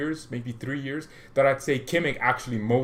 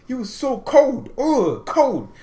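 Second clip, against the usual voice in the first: high, loud and slow, savouring it; hyped-up rave; 11 semitones higher, 5 dB louder, slower than usual. Real, not acted. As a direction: rave about him like a fan. Raving about a defender.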